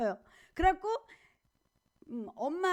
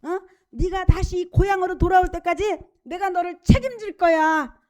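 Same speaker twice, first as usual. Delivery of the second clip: louder, ringing promise of protection; God promising a runaway he'll be kept safe, louder; God's promise; 2 semitones higher, 7 dB louder than usual. Acted. God promising fleeing Jacob protection, louder and ringing.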